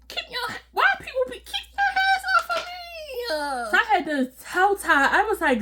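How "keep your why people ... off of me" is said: squealing high and laughing, hands off me; high mock protest. Shoving the coworker off, a high laughing squeal. Acted quarrel, pushing Ty off.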